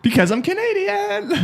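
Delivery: put-on high, slow Canadian-accented protest; drawn-out, hammed-up Canadian accent. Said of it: "how's my Canadian accent?".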